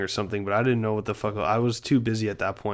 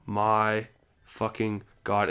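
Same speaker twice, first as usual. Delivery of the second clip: slow disbelief; 3 semitones lower, 3 dB quieter, much slower than usual. Retold or imitated. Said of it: "I was just like".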